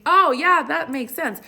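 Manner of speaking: high, sing-along nodding imitation; mock agreement. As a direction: parody someone agreeing without understanding. Mocks people who just agree.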